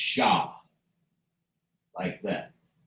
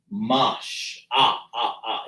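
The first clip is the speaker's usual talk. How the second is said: "mash" very slow, then "ah, ah, ah" repeated; slow sounding out; 4 semitones lower, much slower than usual. Shown as a demonstration. Teacher drilling a vowel for a Hebrew class. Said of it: "we say ah".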